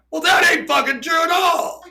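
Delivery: high, loud indignant yell; outraged objection. Retold or imitated. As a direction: shout it like an outraged caller. Kids phone to angrily object.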